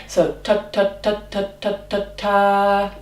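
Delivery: short detached ta ta ta, each cut off crisply; staccato ta. Shown as a demonstration. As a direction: spit out every ta short and separate. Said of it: "it's a kind of a staccato sound".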